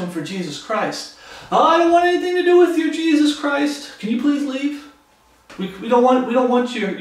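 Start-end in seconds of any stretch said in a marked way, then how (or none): 1.5-7.0 high, loud, fast mock brush-off; higher, louder dismissive villager voice; mock townspeople shooing. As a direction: play the fearful townsfolk pushing Jesus away. Preacher acts townspeople sending Jesus away.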